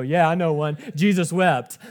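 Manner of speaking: loud, pleased-with-yourself mock answer; eager joker. Someone in a Bible circle shows off a memorized verse, jokily eager. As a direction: blurt it out as a cheeky punchline. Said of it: "And you go".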